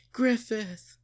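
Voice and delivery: high, loud cry of his name; calling out. Acted. Casca seeing Griffith return, a high loud cry.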